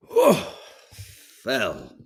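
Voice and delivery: mock weary old man's "Oh, Thel"; sighing as you sit down like granddad; granddad's sigh. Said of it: "he'd go".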